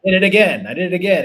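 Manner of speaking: loud, high frustrated groan at yourself; mock self-scolding cry; exasperated self-talk. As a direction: berate yourself for slipping up. Acting out self-blaming inner voice.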